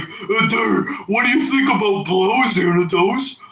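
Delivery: high, whiny imitation of a nagging fan; mock pestering fan voice; mocking viewer voice. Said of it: "asking me, like".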